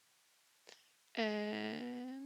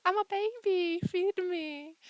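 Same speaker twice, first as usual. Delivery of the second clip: put-on high, loud babyish voice; 11 semitones higher, 7 dB louder than usual. Vlogger voicing a baby demanding to be fed.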